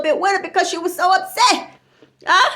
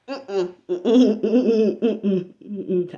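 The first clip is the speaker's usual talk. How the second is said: suppressed laugh hums; 10 semitones lower, much slower than usual. Real, not acted. Refusing to laugh at friend.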